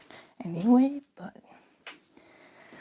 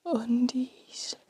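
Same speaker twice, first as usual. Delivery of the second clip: whispering. Real, not acted. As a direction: whisper so no one hears. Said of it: "I don't know why I'm whispering so much".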